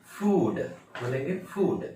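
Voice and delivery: फूड with a lengthened oo; correct pronunciation. Shown as a demonstration. Teacher repeating the word for learners, long-vowel demonstration. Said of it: "इसका original pronunciation".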